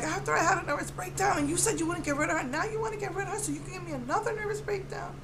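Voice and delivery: high, fast, pleading son's voice; imitating a distraught teen son; upset son. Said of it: "He's like".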